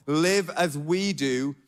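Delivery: ordinary delivery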